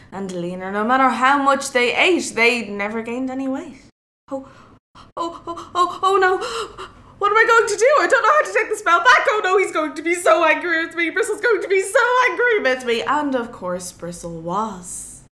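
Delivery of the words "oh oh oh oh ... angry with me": high, fast, fretful wailing; a pig keeper in a flap, rushing; panicked fret. Acted. Grunt panics over the spell.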